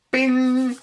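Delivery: high, loud bright "bing" sound effect; excited bing. Retold or imitated. Telling a friend about his deck idea, excited high bing. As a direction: pop out a sudden high "bing".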